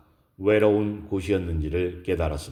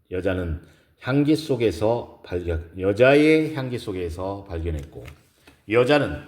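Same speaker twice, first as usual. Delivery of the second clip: ordinary delivery, nothing marked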